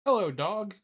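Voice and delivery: lightly voiced timid bug; Billy greeting. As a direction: call out hopefully to the dog. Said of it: "Billy Bollweevil said".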